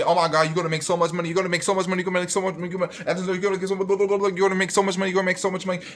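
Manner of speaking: high, rapid-fire babble imitating gushers; mocking hype. Creator mimics everybody hyping up nurse pay, mocking. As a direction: rattle it off high, running the repeats together.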